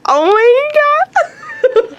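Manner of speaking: overwhelmed with delight; giddy. Real, not acted. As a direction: squeal then giggle. Gushing over her drawing.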